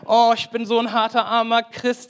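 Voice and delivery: a hint of a moaning believer; mock self-pity.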